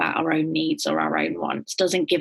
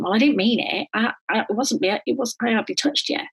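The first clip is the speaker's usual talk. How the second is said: minimizing abuser; 6 semitones higher than usual. Retold or imitated. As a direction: wave it away like it was nothing. Abuser denying intent.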